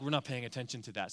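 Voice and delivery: lightly mocked careless people; dismissive mumble. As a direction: brush it off carelessly. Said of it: "They were like".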